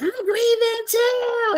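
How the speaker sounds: high, drawn-out wail, imitating her; hammed-up tearful screech; mock crying scream. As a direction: wail it way up high, stretching the words. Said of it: "She started screaming, crying, going on".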